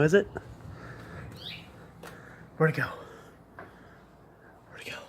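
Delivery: ordinary talk, nothing marked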